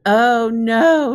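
a loud moan of dismay, the voice sinking; a rabbit moaning over lost shamrocks. A narrator's reading. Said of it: "he moaned".

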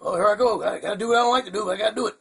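ordinary talk

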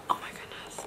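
hushed, breath-only amazement; awed whisper. Real, not acted. Vlogger amazed by the products, awed whisper. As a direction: let it out as a whisper.

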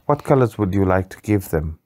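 usual speaking voice

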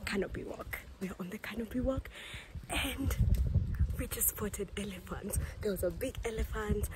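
hushed whisper so the elephants stay calm. Real, not acted. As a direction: whisper it softly. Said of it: "And now I have to whisper".

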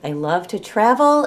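ordinary delivery

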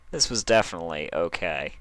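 usual speaking voice

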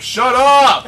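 loud, high yell from the crowd; shouted retold heckle; heckler's shout.

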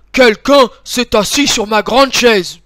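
loud, booming cry from the big bear; big-voiced bear exclaiming. A narrator's reading. Big bear discovers someone sat on his chair, big loud voice. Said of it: "s'écrie de sa grosse voix".

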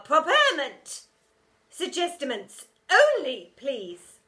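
loud, high, slow scolding; stern teacher. A narrator's reading. Sour teacher hushing the hall, loud and prim. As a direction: restore order primly.

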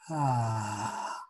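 long soft sighing ah through the mouth; audible exhale. Shown as a demonstration. Leading a deep breath together, soft sighing exhale. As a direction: let the breath fall out as a sigh. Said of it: "long exhale through the mouth".

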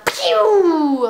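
loud falling game noise; imitated pew. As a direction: mimic a long sliding pew. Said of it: "I don't like the".

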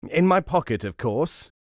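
loud, high burst easing off; mild storybook shout; shouted reply. A narrator's reading. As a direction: shout back indignantly, then drop. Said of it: "he shouted in reply".

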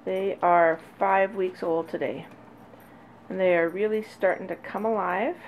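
ordinary talk, nothing marked

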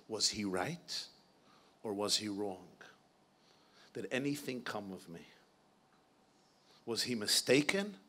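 low, subdued voice of a wounded man; imitating a hurt friend's hushed question; pained retold plea. Troubled friend pleads, retold in hushed voice.

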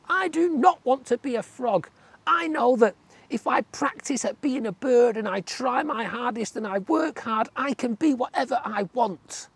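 squeaky tadpole, aghast at frogs. A narrator's reading. Tadpole insists.

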